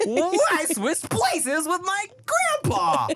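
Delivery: high, drawn-out movie-trailer disbelief; squealed mock horror, stretched out; hammed-up shock. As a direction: play a body-swap comedy hero discovering the switch. Acting out body-swap movie hero.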